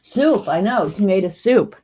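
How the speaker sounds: ordinary talk, nothing marked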